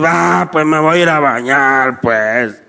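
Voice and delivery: put-on low, reluctant fourteen-year-old; sulky kid giving in; grudging teenager. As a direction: drop the pitch and grumble like a put-upon teen. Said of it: "aquel patojo de catorce años".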